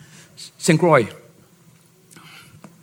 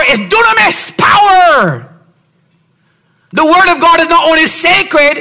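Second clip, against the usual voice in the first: higher, louder sermon delivery; preaching at full force; 11 semitones higher, 5 dB louder than usual. Acted. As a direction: preach it out to the congregation. Preacher building up.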